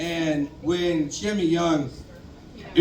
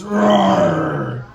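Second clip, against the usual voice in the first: growling roar; 2 semitones lower than usual. Retold or imitated. Roommate growling at the dad all night, mock roar. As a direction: growl it out like a wounded animal. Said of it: "is just growling".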